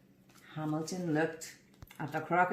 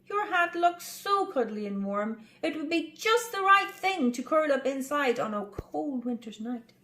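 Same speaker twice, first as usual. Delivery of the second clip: high, wistful mouse voice; hopeful tiny mouse; 6 semitones higher than usual. Acted. Tiny mouse wishing for the hat, high. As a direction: ask longingly.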